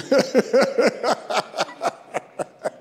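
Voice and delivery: high, loud laugh. Real, not acted. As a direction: burst into a bright laugh. Joking about blocked arteries.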